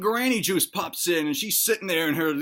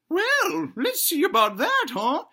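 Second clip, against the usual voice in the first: high put-on granny voice; challenging granny; 13 semitones higher, 3 dB louder, slower than usual. Granny about to zap the boy into the game, challenging. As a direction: falsetto granny with a veiled threat. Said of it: "she's like".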